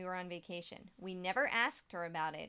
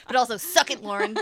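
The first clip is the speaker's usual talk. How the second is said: loud playful taunt; taunting shout; 2 semitones higher, 14 dB louder than usual. Real, not acted. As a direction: yell it as a teasing jab. Joins the mock taunt.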